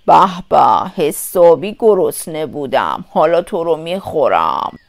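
deep, menacing wolf voice; gloating wolf. A narrator's reading. Wolf leaps in on grandmother, deep gloating threat. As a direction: relish it in a low voice.

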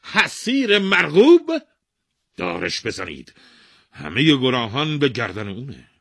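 furious king ordering the weaver hanged; angry shout. Acted. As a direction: explode in outrage. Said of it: "با عصبانیت فریاد زد".

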